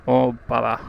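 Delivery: usual speaking voice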